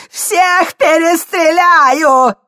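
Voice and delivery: high, slow screaming threat; furious scream. Acted. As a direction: scream the threat at the top of your lungs. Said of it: "завопил старик".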